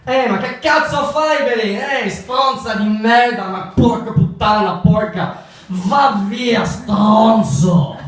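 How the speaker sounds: high, loud mock Italian yelling; angry Italian. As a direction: hurl Italian curses like a street fight, hammed up. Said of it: "Sounds quite angry".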